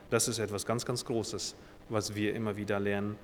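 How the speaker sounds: ordinary talk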